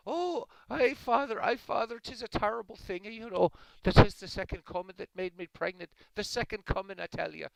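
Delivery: high, loud Irish woman wailing to her priest; hammed-up panicked confession in a brogue; distraught Irish woman. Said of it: "this Irish woman goes to confession".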